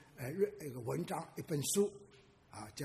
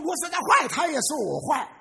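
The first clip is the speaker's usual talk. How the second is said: put-on aggrieved child; 10 semitones higher, 11 dB louder than usual. Child explains to mother, aggrieved.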